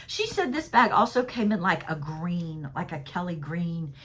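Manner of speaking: ordinary talk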